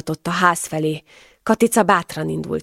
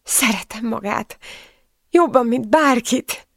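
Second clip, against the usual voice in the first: high, slowing tearful voice; tearful confession; 6 semitones higher, slower than usual. A narrator's reading. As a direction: confess love through tears. Elsírtam magam. (sírós, megindult vallomás)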